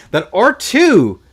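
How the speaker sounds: high, loud, drawn-out call; commentator's excited call. Real, not acted. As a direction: call the big move loudly, stretched out. Live play-by-play exclamation.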